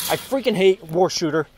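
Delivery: ordinary talk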